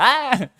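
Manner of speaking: sudden high yelp, mimicking a cat; startled-cat imitation. Cat caught sneaking in the house, imitated startled shriek. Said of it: "And she's like".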